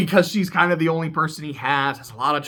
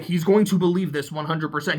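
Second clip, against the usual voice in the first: ordinary delivery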